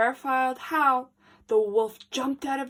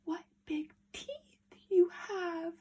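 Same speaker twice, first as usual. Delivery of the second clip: frightened whisper; scared little girl whisper; 8 semitones higher, 5 dB quieter than usual. Acted. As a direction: breathe the question in fear. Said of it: "Little Red whispered".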